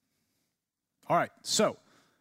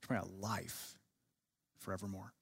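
much lower and softer, a solemn landing on "forevermore"; hushed weighty close; 6 semitones lower, 12 dB quieter than usual. Real, not acted. Teacher driving home the psalm's meaning to the class, low and soft. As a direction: bring it down to a near hush for the final words. Lands the poem's final point.

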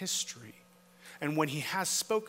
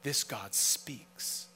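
quiet and slow; 4 semitones lower, 6 dB quieter, much slower than usual. Acted. Quiet slow restatement of the point.

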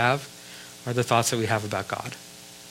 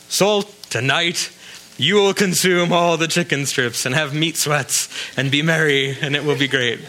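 mock self-pep talk; 3 semitones higher, 3 dB louder than usual. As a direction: ham up a man coaxing his soul. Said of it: "who goes to chicken strip night and says".

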